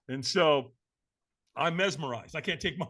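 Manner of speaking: ordinary talk, nothing marked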